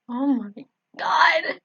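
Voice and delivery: breathless whispered gasp; stunned whisper of horror; choked whisper. Acted. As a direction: choke the words out in a hushed, slow whisper. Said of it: "I choked out".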